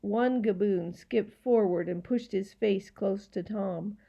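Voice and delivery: plain reading voice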